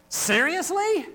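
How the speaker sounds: high, loud incredulous "Seriously?"; exclamatory question. Shown as a demonstration. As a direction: demo of shocked disbelief. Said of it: "and you respond".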